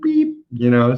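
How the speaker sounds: ordinary delivery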